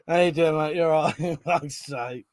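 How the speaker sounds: normal talking voice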